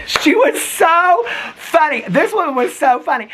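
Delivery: laughing through the words, pitch way up. Real, not acted. Vlogger to viewers, laughing hard. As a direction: still cracking up while saying it. Laughing recalling funny woman.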